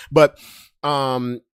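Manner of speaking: normal talking voice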